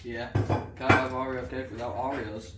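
ordinary delivery

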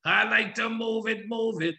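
a hint of Cookie Monster on the hook; mild Cookie Monster growl, chanted. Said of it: "your Cookie Monster voice".

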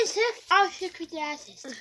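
garbled through a mouthful; eating sour candy. Real, not acted. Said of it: "no clue what this man is saying".

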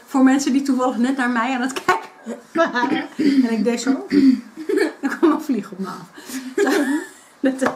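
laughing through an explanation. Real, not acted. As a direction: giggle while explaining. Laughing about swatting a fly.

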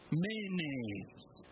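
"Mayonnaise." spoken as the Greek Menin, men-EEN; Greek word Menin. Shown as a demonstration. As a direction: ancient Greek opening word, slow and deliberate. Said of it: "Actually, it's Greek".

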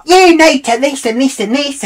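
high, loud mock screeching; hammed-up screeching woman; mocking her screech. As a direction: imitate her shrill rant. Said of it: "she's like".

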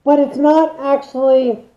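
muffled behind face mask; speaking through a respirator. Real, not acted. Seen 0: dual-cartridge respirator worn over mouth throughout labelled speech.

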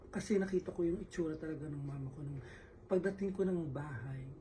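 ordinary talk, nothing marked

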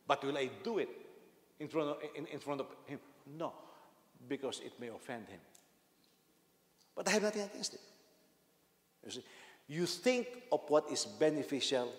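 normal talking voice

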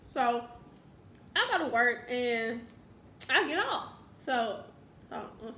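talking while eating food; mouth full, chewing. Real, not acted. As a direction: fingers at lips, eating. Seen 4: Fingers at lips putting food in mouth throughout the words.

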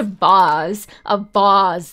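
vase said as vahz, slow and drawn out; vahz pronunciation. Shown as a demonstration. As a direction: say vase like vahz, rhyming with spa. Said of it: "people hate whenever I say it like that".

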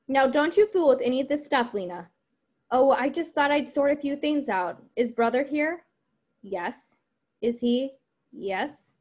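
lively read-aloud of the script's dialogue, voices lightly acted; reading a play aloud. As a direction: read the scene to the class, giving the lines some life. Reading Ruth and Mama.

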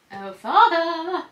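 loud, drawn-out call for Dad; high-pitched sing-out to summon a parent. Real, not acted. Daughter needs her dad's help, calling out.

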